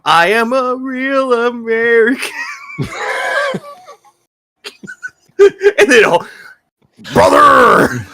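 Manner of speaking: high, slow real American promo; wrestling promo voice. Acted. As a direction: shout it like a pro wrestler. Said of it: "you ready for this wrestling promo".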